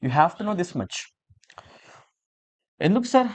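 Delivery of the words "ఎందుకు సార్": high, puzzled student asking why; curious student asking. Pretend. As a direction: pitch it up like a pupil asking the teacher. Teacher voices a student's question. (ఆసక్తిగా అడిగే విద్యార్థి గొంతు, ఎత్తైన స్వరంతో)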